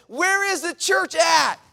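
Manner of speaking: slow, loud, high shout; yelling. Retold or imitated. Stranger following the preacher, slow loud shout. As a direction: yell it after him down the stairs. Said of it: "he just keeps yelling and yelling".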